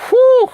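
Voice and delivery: a loud, very high whistling whew; long whew. Real, not acted. Overwhelmed by a viewer's copyright question, a loud high whew.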